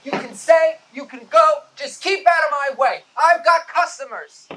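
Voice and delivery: loud, high, exasperated telling-off; slow it down to lay down the law; fed-up scolding. Acted. Owner snapping at a man.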